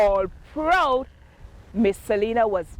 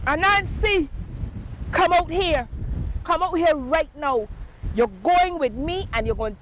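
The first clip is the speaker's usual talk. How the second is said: angry call; 6 semitones higher than usual. Acted. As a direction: call him out, demanding. Angry Tiger calling at door.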